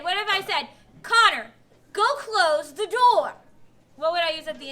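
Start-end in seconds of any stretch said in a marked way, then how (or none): 1.0-3.3 louder, slowed, firm order to Connor; loud, emphatic demand; loud command. Shown as a demonstration. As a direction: call him out sharply to get moving.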